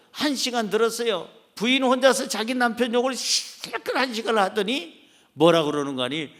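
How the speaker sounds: normal talking voice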